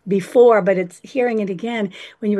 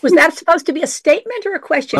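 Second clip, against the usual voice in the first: quick teasing question; 6 semitones higher, 3 dB louder, much faster than usual. Real, not acted.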